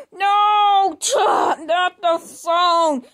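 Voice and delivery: loud, slowed wail over the phone; anguished crying shout. Acted. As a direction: cry out in dismay. Doll grabs her phone.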